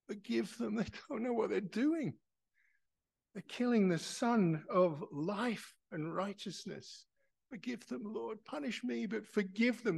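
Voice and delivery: high, loud pleading cry; loud plea. Retold or imitated. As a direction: beg loudly for mercy on them. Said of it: "in a loud voice".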